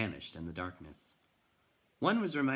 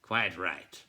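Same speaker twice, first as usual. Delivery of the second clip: grumbled; 4 dB louder than usual. A narrator's reading. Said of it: "the man grumbled".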